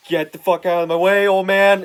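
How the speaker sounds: loud snarling shout, shoving someone aside; angry shout. Retold or imitated. Thug shouting at the old reporter in his way, furious yell.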